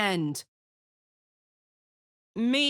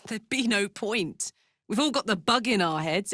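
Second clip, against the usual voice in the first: ordinary delivery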